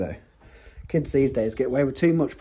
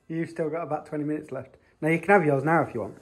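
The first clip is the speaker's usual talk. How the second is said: ordinary talk, nothing marked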